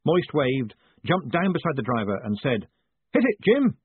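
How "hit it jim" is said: high, loud urgent shout; calling out. Acted. Moist to his coachman beside him, high excited shout. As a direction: shout the order up to the driver. Passenger calls to driver to go.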